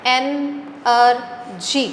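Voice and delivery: "एन आर जी" in three separate beats; teacher demonstrating three distinct sounds, "एन आर जी"; split into syllables. Shown as a demonstration. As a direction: pause between "एन", "आर" and "जी". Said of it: "अलग अलग sound आ गई".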